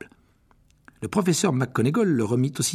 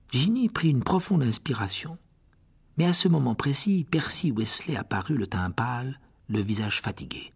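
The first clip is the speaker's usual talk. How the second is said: usual speaking voice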